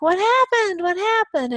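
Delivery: high, loud concerned friends; mock worried crowd asking; high alarmed chorus of friends. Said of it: "everyone's like".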